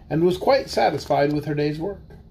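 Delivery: ordinary delivery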